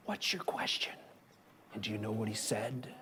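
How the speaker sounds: hushed low whisper. Retold or imitated. Retelling the moment to kids in a whisper. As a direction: keep it a secret.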